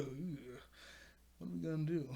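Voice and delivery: soft, mock-defeated aside; retold resigned groan. The duo gives in to her, in softly mocked resignation. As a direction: imitate a grudging give-in, quietly.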